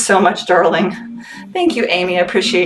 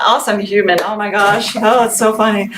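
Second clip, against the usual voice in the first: normal talking voice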